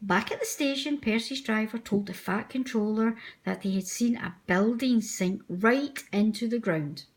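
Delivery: ordinary delivery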